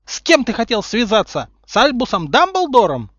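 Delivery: high, loud accusing questions; angry interrogation. A narrator's reading. Umbridge interrogating Harry, angry and loud. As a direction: fire the questions furiously.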